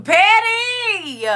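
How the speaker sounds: calling Patty out high and loud; excited shout. Real, not acted. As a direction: squeal her name with joy.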